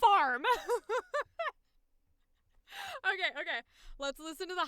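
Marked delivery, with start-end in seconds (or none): 0.0-0.4 Farm barked out loud; a loud punched Farm; blurted farm. Retold or imitated. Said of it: "that take where I'm going". 0.5-1.5 rising high cackle; high helpless laugh. Real, not acted. Laughing at own blunder.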